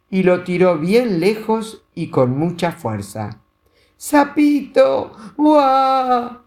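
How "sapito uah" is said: high, loud crying wail; toddler wail. A narrator's reading. Little girl as her toad is thrown, loud crying wail. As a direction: howl like a toddler losing a toy.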